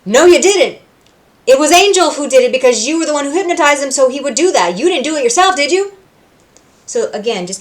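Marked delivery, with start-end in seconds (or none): 0.0-5.9 mocking retort. Character retorting in retold scene.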